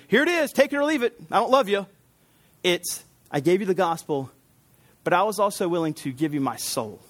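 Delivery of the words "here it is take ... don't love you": high, brusque mock dismissal; mock Bible-thumper. Uncaring preacher thumping listeners with the Bible, mock brusque. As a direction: throw it at them like an uncaring zealot. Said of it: "beat you over the head with the Bible".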